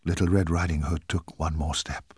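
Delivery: ordinary delivery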